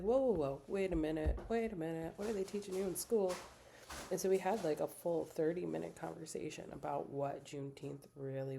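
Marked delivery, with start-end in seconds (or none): 0.0-3.3 alarmed interruption. Retold or imitated. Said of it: "And I was like".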